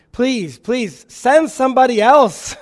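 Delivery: high, loud, begging whine; pleading Moses. Acted. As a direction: beg God to send anyone else. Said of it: "But Moshe pleads here again".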